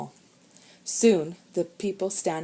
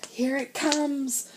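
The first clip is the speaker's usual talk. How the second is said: warning; 8 semitones higher, slower than usual. A narrator's reading. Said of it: "warned Sean".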